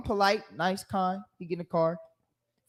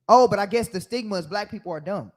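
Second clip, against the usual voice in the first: ordinary talk, nothing marked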